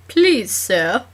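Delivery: lightly British, polite cat begging; a hint of a British Oliver Twist; British accent. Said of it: "he said in a British accent".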